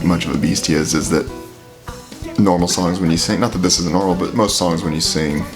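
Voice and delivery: ordinary delivery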